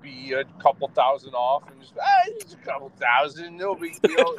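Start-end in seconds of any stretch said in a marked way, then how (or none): 2.0-4.3 nonchalant. Retold or imitated. Retelling own casual miscounting at work.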